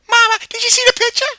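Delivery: high, loud and fast; proud of her drawing; eager question. Acted. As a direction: kid showing off to mom. Excited child to mom.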